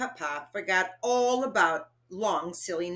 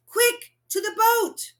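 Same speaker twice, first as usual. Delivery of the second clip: urgent shout; 14 semitones higher, 6 dB louder than usual. Acted. Father urgently calls.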